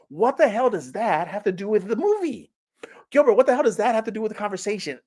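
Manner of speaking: mock-indignant complaint at Gilbert; exasperated viewer. Viewer scolding Gilbert about the movie, mock exasperated. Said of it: "and you're like".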